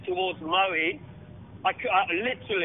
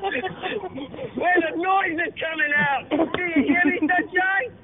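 higher, hammed-up tough-guy threat; answering the French rival with a mock tough-guy threat, higher and hammed-up; mock trash talk; 7 semitones higher, slower than usual. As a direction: growl it like an action hero, playful.

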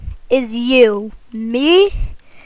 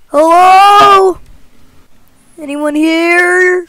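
loud high call into emptiness; 5 semitones higher, 5 dB louder, much slower than usual. Real, not acted. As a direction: call out to anyone there. Calling out in empty world.

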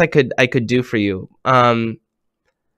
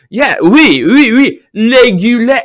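mock French accent, slowed on régulier; French attendant; 9 semitones higher, 7 dB louder than usual. Host retelling the French gas attendant, mock French accent. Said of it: "He said".

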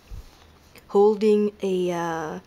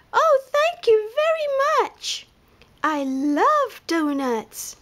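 much higher, then slow and happy; delighted lemur; 13 semitones higher than usual. A narrator's reading. Lemur thanks panda for all the donuts, high and delighted. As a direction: gush your thanks like a tiny lemur.

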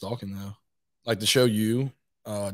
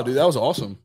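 ordinary delivery

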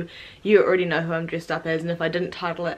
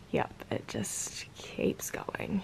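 whispering; 9 semitones lower, 14 dB quieter, much slower than usual. Real, not acted. Aside while reading guesses.

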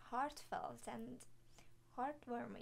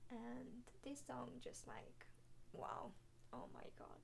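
soft, near-whispered, choked-up voice; holding back tears; 9 dB quieter than usual. Real, not acted. Reactor telling herself not to cry over the song, tearfully hushed. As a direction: let it fade almost to a whisper, fighting tears. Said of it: "Let's stop crying".

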